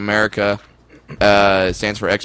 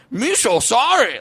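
put-on high, slow caricatured Chinese accent; badly faked Chinese accent, pitched way up; hammed-up film-caricature Chinese accent; mock Chinese accent; 10 semitones higher, slower than usual. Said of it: "I don't know why the Chinese accent".

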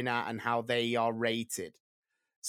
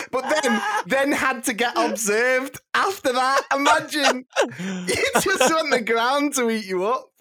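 higher, louder, laughing through it; 12 semitones higher, 9 dB louder than usual. Real, not acted. Retelling the teacher's kiss, laughing loudly. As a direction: giggle through the words.

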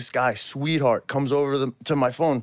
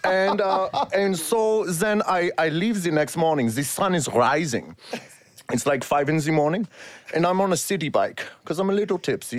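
storytelling in a put-on German accent; 2 semitones higher than usual. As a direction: narrate it as a comic German. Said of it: "tell the story in the German accent".

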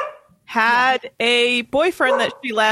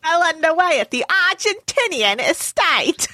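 higher-pitched Kiwi attempt; New Zealand accent; 5 semitones higher than usual. Shown as a demonstration. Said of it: "this is New Zealand. Ready?".

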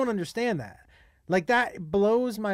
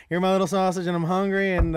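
laughing; 3 dB louder than usual. Real, not acted. Repeats line laughing.

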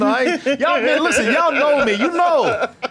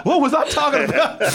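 ordinary delivery

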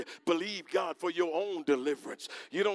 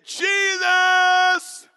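much higher, much louder drawn-out call of "Jesus"; crying aloud; 13 semitones higher, 14 dB louder, much slower than usual. Acted. Lepers crying aloud to Jesus, long desperate shout. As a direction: stretch the name out in a wail. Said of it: "They cried aloud".

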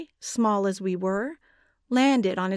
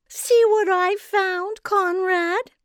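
high, loud, slow sugary question; syrupy giantess; 11 semitones higher, 5 dB louder, slower than usual. A narrator's reading. Giantess showing the children to Conrad, syrupy high. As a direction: drip honey on every word. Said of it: "she said in her syrupy voice".

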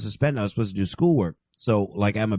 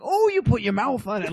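laughing disbelief; 11 semitones higher than usual. Retold or imitated. Kid's shocked realization, laughing.

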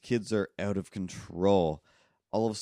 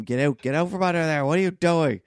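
loud, high mock scolding shout; exasperated adult yelling, acted out at full volume; imitated angry yelling; 9 semitones higher, 8 dB louder than usual. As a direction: yell at a kid to clear out. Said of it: "they yell at him".

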